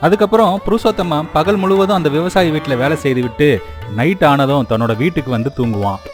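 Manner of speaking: plain reading voice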